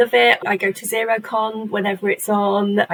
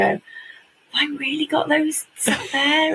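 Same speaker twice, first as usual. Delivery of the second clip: amazed disbelief; 6 semitones higher than usual. Retold or imitated. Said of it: "look at those awards and go".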